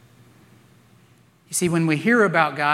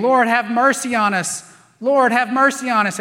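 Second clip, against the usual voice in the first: loud, higher-pitched cry for mercy; mock screaming; 6 semitones higher, 5 dB louder than usual. Blind men call to Jesus walking by, imitated screaming. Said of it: "These two blind men were screaming".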